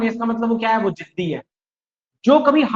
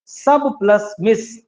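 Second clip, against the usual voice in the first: सब plus मिस spaced out word by word; slow split; much slower than usual. Shown as a demonstration. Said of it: "देखो इसको तोड़ देता हूं".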